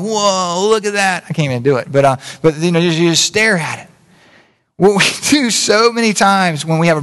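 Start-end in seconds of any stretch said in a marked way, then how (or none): 0.0-1.2 higher, airy whoa of a gawking driver; rubbernecker gawking. Retold or imitated. Rubbernecking driver to passengers, gawking whoa. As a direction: gape at the wreck as you crawl by. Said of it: "they're going".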